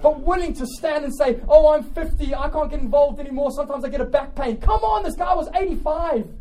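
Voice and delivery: high, loud, fired-up sermon; impassioned pulpit exhortation; full-force preaching. Real, not acted. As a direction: preach it hard to rouse the congregation.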